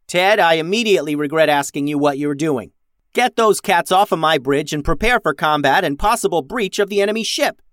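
forceful, exasperated captain; ordering. A narrator's reading. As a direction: cut him off and give orders. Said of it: "ordered Max".

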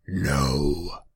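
loud, drawn-out demonic denial; big ominous creature voice. A narrator's reading. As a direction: stretch the word out like a demon. Demon answers calmly.

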